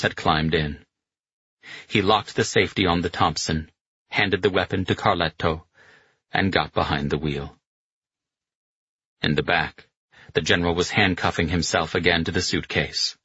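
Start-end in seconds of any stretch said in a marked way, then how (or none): none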